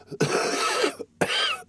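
breathy, unvoiced coughs; acted coughing fit. Dying woman coughs as she speaks, acted coughing.

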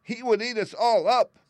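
high, loud alarmed mouse voice; frightened squeak. A narrator's reading. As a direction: panic like a tiny mouse. Queen frightened of lion.

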